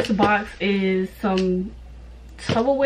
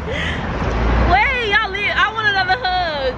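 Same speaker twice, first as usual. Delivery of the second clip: high, whiny plea; pleading; 10 semitones higher, slower than usual. Real, not acted. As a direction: beg them to stay, high. Begging family not to leave.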